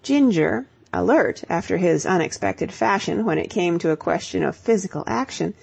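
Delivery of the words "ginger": usual speaking voice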